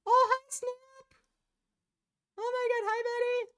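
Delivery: very high squealed greeting; high-pitched pet voice. Real, not acted. Streamer greeting a cat, very high-pitched pet voice. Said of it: "go in that most high-pitched voice".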